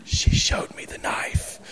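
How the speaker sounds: hushed joking whisper. Real, not acted. Pastor confiding a joke to the congregation in a whisper. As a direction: whisper it like a secret. Joking aside about wife's threat.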